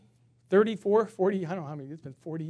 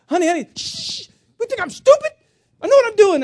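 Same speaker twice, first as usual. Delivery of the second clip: much higher and louder, a hammed-up exasperated wife; scolding wife; 13 dB louder than usual. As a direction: squeal it like an indignant wife. Wife voiced scolding husband.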